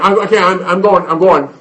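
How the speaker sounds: put-on loud, high protest from a nagged kid; exasperated child.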